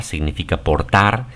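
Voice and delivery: usual speaking voice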